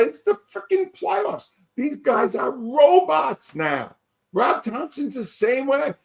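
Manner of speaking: worked-up fan ranting about the playoffs; exasperated rant. Real, not acted. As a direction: vent like a furious fan at the game. Fan rant about pulled pitcher.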